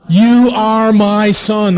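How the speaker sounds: slow, high and loud, a grand proclamation; booming voice of God. Acted. God blessing his son at the baptism, slow and booming. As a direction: announce it as God over the water.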